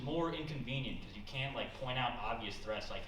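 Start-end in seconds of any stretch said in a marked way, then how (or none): none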